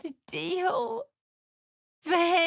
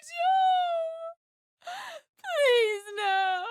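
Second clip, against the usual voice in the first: higher, sobbing plea; anguished crying; 11 semitones higher, much slower than usual. Acted. Wife watching her husband lost, crying out. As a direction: cry out his name in despair.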